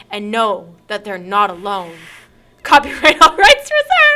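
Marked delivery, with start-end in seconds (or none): 2.6-4.2 laughing. Acted. Mock formal intro sign-off.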